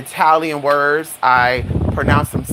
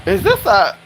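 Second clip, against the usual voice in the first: surprised. Retold or imitated. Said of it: "and I'm like".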